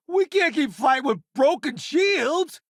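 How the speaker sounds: high, alarmed call; alarmed shout. Acted. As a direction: warn the crew in alarm. Alarm in combat.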